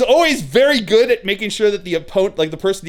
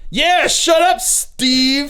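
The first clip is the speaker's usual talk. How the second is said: higher, dismissive brush-off; 7 semitones higher, much slower than usual. Retold or imitated.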